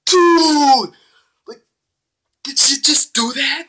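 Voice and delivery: high, loud Dude, did she just do that; shocked disbelief. Retold or imitated. Recalling watching Misaki bang the head, high-pitched shock. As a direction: gasp it out in disbelief. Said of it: "I'm like".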